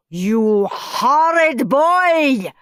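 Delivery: loud, slow furious teacher; screeched. A narrator's reading. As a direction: spit out each word, slow and loud. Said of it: "screeched Mister Nurden".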